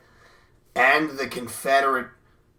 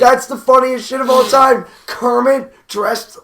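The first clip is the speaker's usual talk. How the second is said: loud, higher-pitched raving; delighted outburst; 8 semitones higher, 8 dB louder than usual. Real, not acted. Podcaster raving about Kermit's costume, delighted outburst. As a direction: gush loudly over the funniest thing ever.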